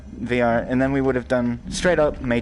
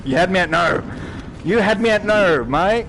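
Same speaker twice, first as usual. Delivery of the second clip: playful riff; 7 semitones higher, 5 dB louder than usual. Pretend. Joking riff on quote.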